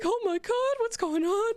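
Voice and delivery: high-pitched, startled blurting; playful high-voiced panic; mock alarm. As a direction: act out a flustered reaction, voice shooting up. Acting out a startled reaction.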